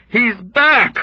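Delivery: high, loud hysterical cry; loud hysterical chant; hysterical calling. Acted. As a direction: call it out hysterically. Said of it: "chanting and calling out hysterically".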